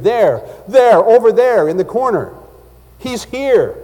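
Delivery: loud, high cry of alarm; panicked shout, pitched far above normal; terrified scream. Acted. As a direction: cry out as if spotting a horror in the room. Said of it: "Suddenly, he screamed".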